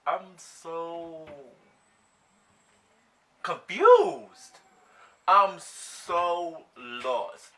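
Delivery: loud, high, drawn-out bewilderment; dramatic, lost wail; exaggerated confusion. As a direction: stretch it out slowly, totally baffled. Acting out confusion at lock screen.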